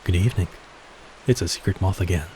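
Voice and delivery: usual speaking voice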